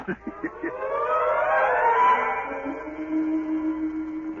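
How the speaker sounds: high, gloating cackle of a trickster; gleeful laugh. Acted. Schemer laughing to himself over his turtle trick, gleeful cackle.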